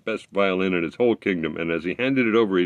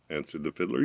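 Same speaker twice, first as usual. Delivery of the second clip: plain reading voice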